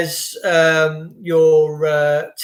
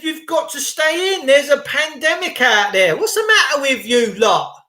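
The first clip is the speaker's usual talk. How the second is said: higher, mocking government voice; a hint of Boris; mock Boris Johnson; 6 semitones higher than usual. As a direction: lampoon the prime minister's order. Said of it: "Doris's Johnson said".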